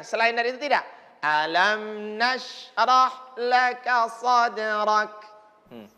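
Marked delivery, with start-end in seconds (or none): 1.2-5.1 slow recitation, lips moving only on the m; careful lip movement. Shown as a demonstration. Teacher shows lip movement, slow. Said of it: "hanya mm berarti bergeraknya".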